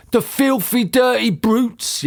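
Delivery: high, drawn-out outrage; angry yell. A narrator's reading. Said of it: "yelled Mister Twit".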